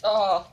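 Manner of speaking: loud, high yelp of cold. Real, not acted. Cold shock in water.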